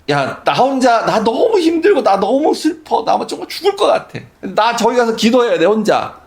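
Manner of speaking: high, strained plea to the disciples, a touch of begging; pleading Jesus. Retold or imitated. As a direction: plead with them like a worn-out teacher. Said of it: "제자들한테 애걸하는 것 같은".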